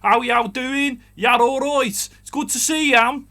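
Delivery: high, loud, slow hammed-up American Southern twang; over-the-top Southern American greeting; mock Southern US drawl. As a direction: ham up a cowboy accent to mock it. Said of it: "you don't sound all".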